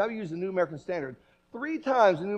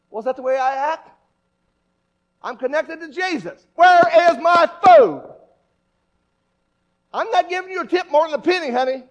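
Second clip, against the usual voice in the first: higher, louder mock demanding customer; rude church diner; 8 semitones higher, 10 dB louder than usual. Preacher mimics a rude Sunday diner scolding the waitress, loud. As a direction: snap at the waitress.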